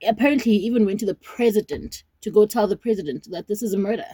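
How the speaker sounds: usual speaking voice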